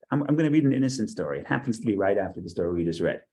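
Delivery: ordinary delivery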